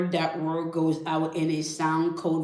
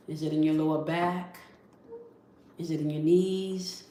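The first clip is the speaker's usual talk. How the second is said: guided breathing. Acted. Teacher guiding listeners through two breaths, gentle meditation voice. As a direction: ease them into the breath and into their bodies.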